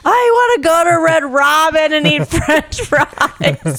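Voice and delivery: high, drawn-out mock whine; hungry tantrum. Hungry girlfriend demands fries from boyfriend, high mock tantrum. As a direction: stretch each word in a hammed-up complaint.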